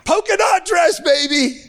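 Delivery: preaching at full force. Real, not acted. Preacher to congregation at the climax of his story, shouting. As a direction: build to a triumphant shout.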